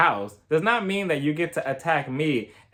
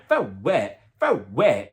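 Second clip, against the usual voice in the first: imitating Erica; 5 semitones lower, much slower than usual. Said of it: "that's her favorite line".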